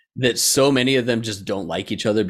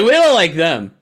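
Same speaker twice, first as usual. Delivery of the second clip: loud, high mock objection; mock protest; 5 semitones higher, 8 dB louder than usual. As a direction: blurt out a mocking objection, raised voice. Mimics countries disliking each other.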